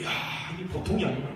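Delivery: fiery pastor rallying the congregation; preaching at full force. Acted. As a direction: drive it home to the church like a revival preacher.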